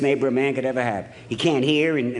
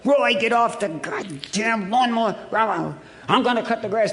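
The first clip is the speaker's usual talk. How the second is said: mock screaming at husband; 5 semitones higher than usual. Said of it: "she's screaming and hollering".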